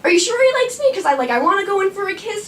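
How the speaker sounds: high-pitched fretful question; anxious high squeak. Retold or imitated. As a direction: squeak it out like a worried teen. Anxiously asking friend about boy.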